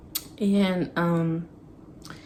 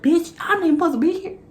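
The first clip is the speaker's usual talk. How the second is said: fast, high panicky rush; paranoid panic; 10 semitones higher, 3 dB louder, faster than usual. Retold or imitated. Retelling sneaking out, she panics that she shouldn't be there. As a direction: blurt it out in a rising panic. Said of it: "I'm paranoid".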